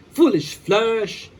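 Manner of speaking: slow, mocking villain taunt; villain's taunt. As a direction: sneer at the hero, slowly. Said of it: "shouted Freeze".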